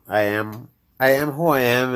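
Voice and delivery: ordinary talk, nothing marked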